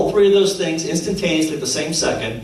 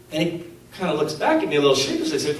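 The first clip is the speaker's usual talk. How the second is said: ordinary talk, nothing marked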